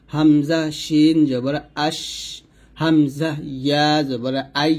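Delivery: slow, drawn-out spelling to 'আশ' and 'আই'; sakin demonstration. Shown as a demonstration. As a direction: hold the closing sounds of 'আশ' and 'আই'. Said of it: "যদি আমরা সাকিন দিয়ে বলি". ('আশ' আর 'আই' সাকিন দিয়ে ধীরে উচ্চারণ)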